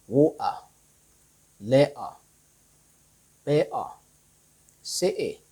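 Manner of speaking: water, letter, better, city with the T caught in the throat; glottal stop. Shown as a demonstration. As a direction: swallow each T as a glottal stop. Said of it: "do not pronounce the T clearly".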